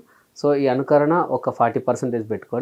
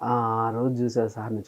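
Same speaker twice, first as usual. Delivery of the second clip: usual speaking voice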